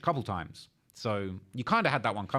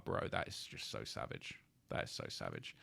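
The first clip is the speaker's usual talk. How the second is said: breathy stunned whisper, much lower and softer; barely voiced, shocked at the lyric; awed whisper; 13 dB quieter than usual. Real, not acted. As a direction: whisper it in disbelief.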